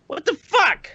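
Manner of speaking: high-pitched exasperated outburst; indignant disbelief. Real, not acted. Defending himself to co-hosts over the tapes, indignant. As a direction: throw it out, appalled at the doubt. Outraged at being doubted.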